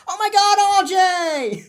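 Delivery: high, loud delighted cry of a name; excited squeal. Retold or imitated. Hope greeting her long-lost brother, high squeal. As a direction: squeal his name like a thrilled sister. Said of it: "She's like".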